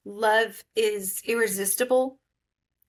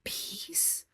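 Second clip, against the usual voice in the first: whispered; 5 semitones higher, 11 dB quieter than usual. Retold or imitated. Retold despairing inner thought.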